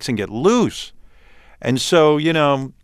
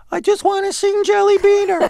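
very high, tearful mock whine; squeaky put-on child's wail; whining little kid; 3 dB louder than usual. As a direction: imitate a little kid crying into the mic. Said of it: "I was crying and whining".

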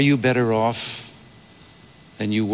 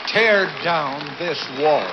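slow, higher, commanding demand; slow gravelly statesman; 4 semitones higher, slower than usual. Real, not acted. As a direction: deliver each word slowly and firmly. Climax of the Berlin speech.

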